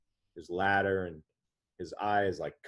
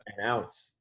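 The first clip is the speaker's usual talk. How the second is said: emphatic; 3 semitones higher, 7 dB louder than usual. Real, not acted. Insisting the story is true.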